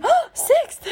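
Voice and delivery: gasped, very high, loud surprise; mock shock. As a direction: squeal it in exaggerated astonishment. Acting out Max's shock at result.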